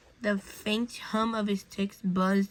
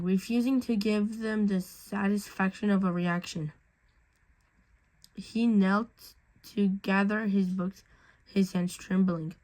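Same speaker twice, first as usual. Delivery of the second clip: ordinary delivery, nothing marked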